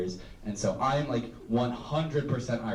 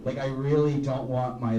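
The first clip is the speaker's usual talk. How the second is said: ordinary talk, nothing marked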